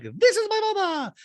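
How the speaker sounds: very high squeaky voice with beep boop robot noises; high mock robot Dorit. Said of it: "And Dorit's like".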